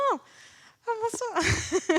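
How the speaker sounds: high, playful mock dismay; mock shock. Presenter feigning shock at the class, high mock surprise. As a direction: act scandalized for the students. Audience doesn't know the show.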